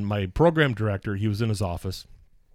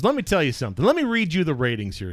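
confronting boss; 6 semitones higher, faster than usual. Retold or imitated. Boss voiced confronting him over ratings.

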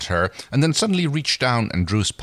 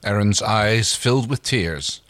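plain reading voice

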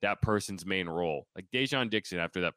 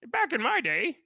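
high-pitched mock old-timer rant; Mad Dog; 3 dB louder than usual. Host saying something stupid, sarcastic high-pitched impression. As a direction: crank it up high, like a yelling ranter. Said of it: "I'll talk like the Mad Dog".

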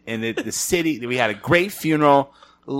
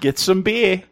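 put-on Maine accent; 7 semitones higher, slower than usual. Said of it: "I'm trying to think of what it sounds like".

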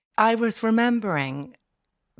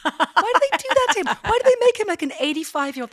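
indignant; 12 semitones higher, 5 dB louder, faster than usual. Real, not acted. Indignant raised pitch.

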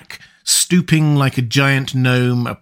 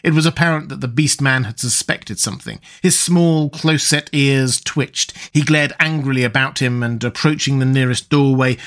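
ordinary delivery, nothing marked

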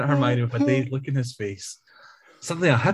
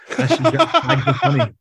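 put-on Cockney accent; 14 semitones higher, 5 dB louder than usual. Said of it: "in her annoying Cockney accent".

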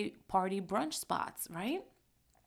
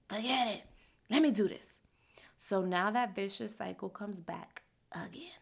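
ordinary talk, nothing marked

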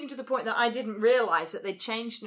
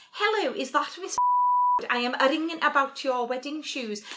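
high-pitched mock-posh Scouse; imitating an over-careful Liverpudlian caller; Liverpudlian trying to be posh; 3 semitones higher than usual. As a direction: put a posh telephone voice over a Scouse accent. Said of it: "a Liverpudlian trying to be posh".

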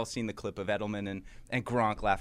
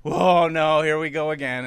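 loud, higher cry of alarm at bad news; dismayed outburst; 5 semitones higher, 11 dB louder, slower than usual. Fan hearing the cheating story, loud alarmed groan. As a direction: blurt it out like a fan who sees trouble coming. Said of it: "and it was like".

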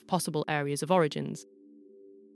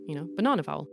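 normal talking voice

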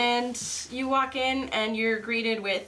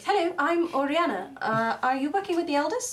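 barmaid voice; 6 semitones higher than usual. Acted. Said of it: "and she's like".

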